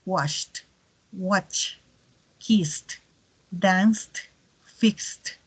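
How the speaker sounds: "helped", "looked", "sniffed" each ending on a crisp puffed "t"; explosive t ending. Shown as a demonstration. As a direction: snap the final "t" on every verb. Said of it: "una especie de T explosiva".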